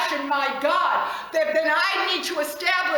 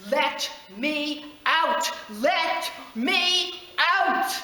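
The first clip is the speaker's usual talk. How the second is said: slow echo; much slower than usual. Acted. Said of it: "Can you hear him?".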